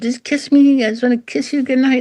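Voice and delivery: mock cooing woman, pitched high; cooing dog lover. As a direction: coo at the dog like a doting owner. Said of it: "I said".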